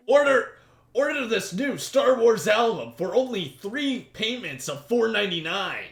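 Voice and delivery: loud, high TV-ad pitchman; infomercial voice. Acted. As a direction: sell it like a late-night ad. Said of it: "That was your, uh, infomercial voice".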